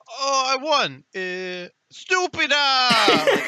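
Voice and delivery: hammed-up Italian accent, high and loud, stretched out slowly on stupida; Italian accent, laughing; mock Italian kid. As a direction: imitate a kid gloating in a fake Italian accent, laughing through it. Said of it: "That's how he does it".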